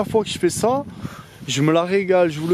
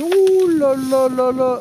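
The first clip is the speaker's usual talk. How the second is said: excited; 8 semitones higher, 4 dB louder than usual. Real, not acted. Smoke pours from the fire.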